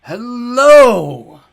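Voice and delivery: high, very loud doddery greeting; frail warble. A narrator's reading. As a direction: warble it out like a batty old god. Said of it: "a frail voice warbled".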